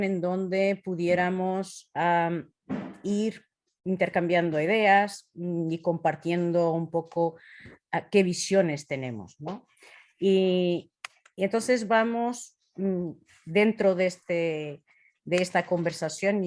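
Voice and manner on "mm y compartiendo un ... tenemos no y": ordinary delivery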